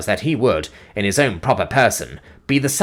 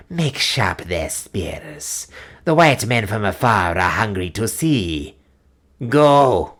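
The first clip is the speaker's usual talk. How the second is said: put-on old witch voice; slower than usual. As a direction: drag it out like an ancient witch. Old witch Gagul commands the executioners.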